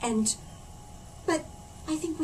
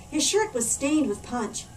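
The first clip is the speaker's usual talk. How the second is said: usual speaking voice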